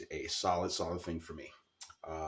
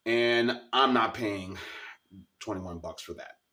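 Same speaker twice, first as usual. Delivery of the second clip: louder, slower, firm refusal; indignant; 4 semitones higher, 7 dB louder, slower than usual. Real, not acted. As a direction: dig in your heels on each word. Indignant about the price.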